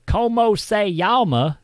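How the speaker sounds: loud, high, slow Southern drawl on the Spanish; Texan-accented Spanish. Retold or imitated. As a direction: ham up a cowboy saying Spanish words. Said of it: "sounding like a Texan".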